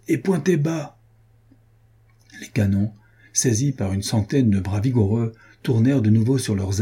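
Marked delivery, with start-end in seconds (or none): 0.1-1.0 high-pitched order, understated anger; furious command. A narrator's reading. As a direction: raise the pitch sharply without shouting. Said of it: "cria un capitaine d'une voix furieuse".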